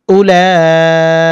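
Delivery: উলাইকা very slow, the "laa" sustained long; four-alif lengthening. Shown as a demonstration. Teacher shows madd, উলাইকা with a very long held "laa". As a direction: hold the "laa" in উলাইকা, then finish "ika". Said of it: "টানতে হবে চার আলিফ".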